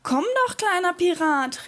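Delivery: high, luring mermaid call. A narrator's reading. Mermaid beckoning the little pirate into the sea, calling. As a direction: call him over in a high voice. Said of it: "rief die Meerjungfrau".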